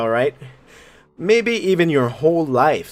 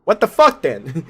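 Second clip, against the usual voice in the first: ordinary delivery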